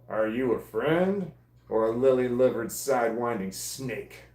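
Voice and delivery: hammed-up American cowboy accent. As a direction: read it like a gunslinger in a Western accent. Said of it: "Should I have said that with my cowboy accent?".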